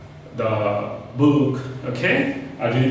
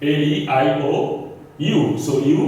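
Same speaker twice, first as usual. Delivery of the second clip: ordinary delivery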